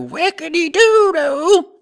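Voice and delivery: high, slow goofy squeal of "Whackity-doo-doo."; silly catchphrase. Retold or imitated. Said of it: "until I go".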